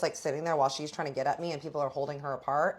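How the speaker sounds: plain reading voice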